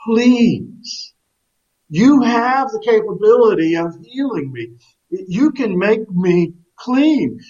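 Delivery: high, slow desperate plea; great emotion. Acted. Leper begging Jesus to heal him, slow desperate high plea. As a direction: beg him for healing with your whole heart. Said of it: "he says with great emotion".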